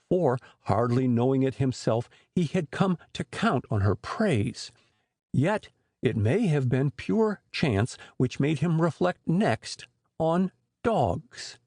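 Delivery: ordinary delivery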